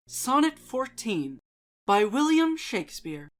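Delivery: measured, expressive recital of poems and prose; dramatic poetry reading. Acted. Channel host reading poems aloud for viewers, dramatic reading. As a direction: read each verse with feeling, letting lines breathe.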